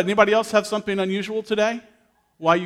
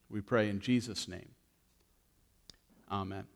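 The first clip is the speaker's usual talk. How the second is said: soft, lower prayer voice; pastoral prayer; 6 semitones lower, 5 dB quieter than usual. Acted. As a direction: pray quietly for the congregation. Leads congregation in prayer.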